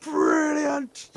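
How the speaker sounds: a jolly dwarf exclaiming; dwarf shout. Retold or imitated. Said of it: "it just goes".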